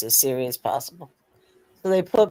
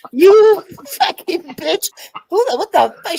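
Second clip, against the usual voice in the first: mock furious yell; 9 dB louder than usual. Retelling how Big Dog Ma cussed him out, high shrieking yell. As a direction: shriek it like the outraged friend. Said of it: "She's cussing at me".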